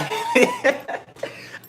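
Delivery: high helpless giggle; laughing. Real, not acted. Giving up on the song for followers, real laughter. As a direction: burst out laughing mid-song. Laughing at own failed singing.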